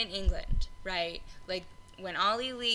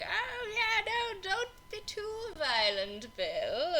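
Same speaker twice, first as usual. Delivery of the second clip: high, teasing, gleeful sneer egging Bill on; 12 semitones higher, slower than usual. Retold or imitated. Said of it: "he's a little too gleeful about".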